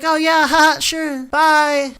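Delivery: high-pitched girl impression with fake laugh; mock polite brush-off. Girl brushing off an invitation to hang out, mock polite. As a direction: imitate an airy, insincere goodbye.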